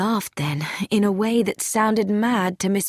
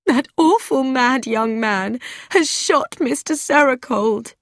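higher-pitched, tearful accusation; 5 semitones higher than usual. A narrator's reading. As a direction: wail it, close to tears. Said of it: "Mildred said tearfully".

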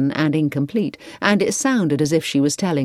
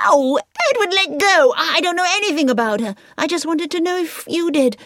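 much higher, startled protest; alarmed; 12 semitones higher than usual. A narrator's reading. Victoria crying out as Edward grabs her arm, alarmed. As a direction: pull free, high and alarmed.

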